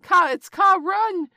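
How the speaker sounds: high, loud terrified shrieks; panicked monkey cries. A narrator's reading. Monkeys fleeing the python, shrieking in panic. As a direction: scream it out, fleeing in terror. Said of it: "The monkeys scattered with cries of".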